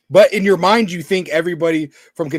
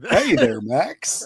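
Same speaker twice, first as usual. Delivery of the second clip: Goofy impression; much slower than usual. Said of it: "you kinda sound like Goofy".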